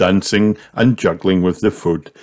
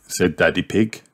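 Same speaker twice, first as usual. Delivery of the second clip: ordinary delivery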